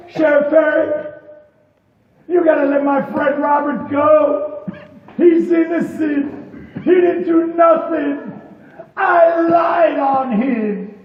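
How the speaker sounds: loud, crying confession; tearful plea. Acted. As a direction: beg through tears, shouting. Guilty friend confesses, begging.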